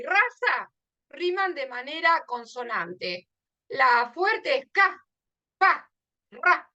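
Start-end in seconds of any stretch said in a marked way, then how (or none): 3.7-6.7 each syllable punched out slowly, higher and louder; stressed syllables. Shown as a demonstration. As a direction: hit ca, pa, ra one by one, loud and high. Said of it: "Esas son las que suenan más fuerte". (sílabas tónicas marcadas, lentas y fuertes)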